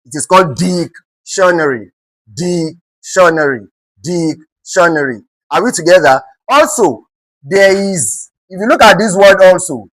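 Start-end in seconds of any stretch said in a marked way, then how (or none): 0.6-5.2 "dictionary" slowly, DIC split off from the rest; stress on DIC. Shown as a demonstration. Teacher showing students how dictionary is stressed, slow first-syllable stress. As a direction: hold "dic", pause, then let "tionary" fall away. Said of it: "Dictionary is stressed on the word dic".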